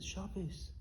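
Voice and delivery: murmured softly, slowly; hushed, tender retelling. Retold or imitated. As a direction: say it gently, as if comforting. Tender retold scene, rubbing his hand.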